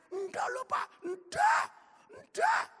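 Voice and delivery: very high, loud shouted denial; protesting shout. Retold or imitated. Tailor protesting his boss's teasing, very high shouted denial. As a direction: cry out no, no, no.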